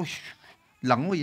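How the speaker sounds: ordinary talk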